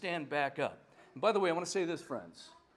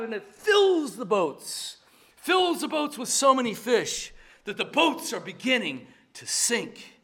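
loud, higher, slow on fills; excited emphasis; 4 semitones higher, 7 dB louder than usual. Real, not acted. Preacher marvelling at the overflowing catch, loud and excited. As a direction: paint the overflowing boats. Preacher builds the miracle scene.

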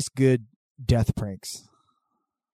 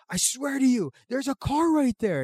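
desperate pleading; 12 semitones higher than usual. Retold or imitated. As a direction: imitate someone swearing he's not crazy. Retold coworker pleading to be believed.

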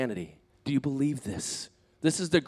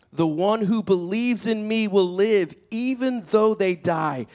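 slow, raised, ringing declaration; emphatic proclamation; 4 semitones higher, 4 dB louder, slower than usual. Retold or imitated. Jesus answering grieving Martha, solemnly emphatic. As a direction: proclaim it slowly and build to the question.

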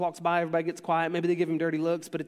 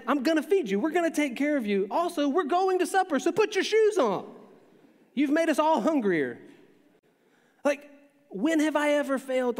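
higher, louder fed-up parent; exasperated dad; 8 semitones higher, 4 dB louder than usual. Retold or imitated. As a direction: raise your voice at a stalling kid. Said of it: "It's like".

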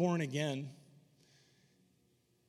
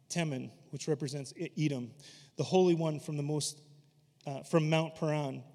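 ordinary delivery

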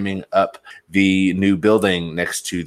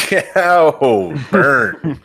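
laughing through the words; 10 semitones higher, 3 dB louder, much slower than usual. Real, not acted. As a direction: crack up while saying it. Laughs at co-host's jab.

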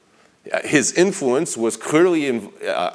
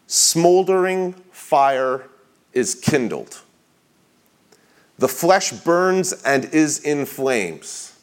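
slow, higher-pitched, drawn-out key words; dramatic quote reading; 4 semitones higher, slower than usual. Acted. Preacher reading how desire seizes the flesh, slow and dramatic. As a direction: let each phrase land before a pause. Reads a book quote aloud.

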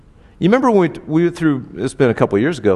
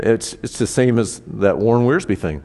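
ordinary delivery

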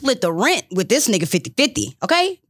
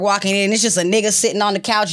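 ordinary delivery

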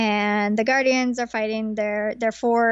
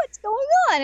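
confused; 3 dB louder than usual. Retold or imitated. Confused kings voiced.

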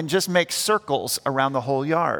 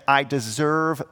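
bad attitude; 5 semitones lower than usual. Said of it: "with a bad attitude".

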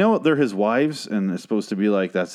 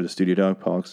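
ordinary talk

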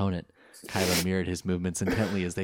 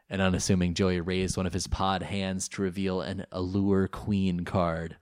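ordinary delivery, nothing marked

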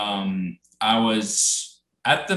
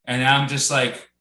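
ordinary talk, nothing marked

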